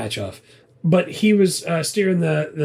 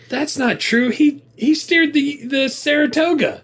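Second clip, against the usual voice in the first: mock indignant; 10 semitones higher than usual. Co-host jokingly defending Captain Ron, mock indignant. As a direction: play along with a fake objection.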